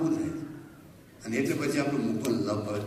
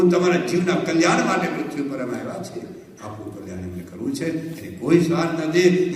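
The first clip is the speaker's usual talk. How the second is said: raised, higher, louder preaching at full force; fervent preaching; 5 semitones higher, 7 dB louder than usual. Acted. Preacher to the assembled devotees, fervent loud preaching. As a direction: preach it out to the whole hall.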